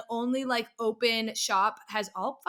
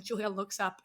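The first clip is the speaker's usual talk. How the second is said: normal talking voice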